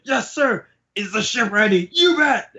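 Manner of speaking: very high, hammed-up eager shouts; frantic crew replies. Crew hurries to obey Queen, high frantic replies. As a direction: pipe up like scrambling henchmen. Crew answering panicked orders.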